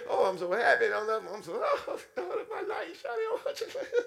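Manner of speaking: mock lovestruck squeal; giddy delight. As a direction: swoon with laughing happiness. Giddy over finding the image.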